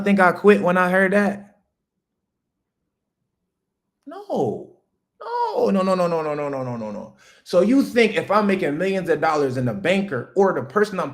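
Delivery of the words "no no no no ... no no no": emphatic no. Real, not acted. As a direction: shoot it down, trailing off. Emphatic answer to his question.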